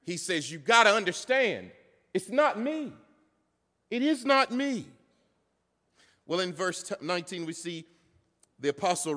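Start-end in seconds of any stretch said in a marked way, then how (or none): 0.5-4.9 high, insistent plea, pausing between phrases; raised, urgent stress on "not me"; very emphatic denial. Acted. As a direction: make them understand, hitting "not me" hard. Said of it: "He wants them to understand. It, it, it would be like this".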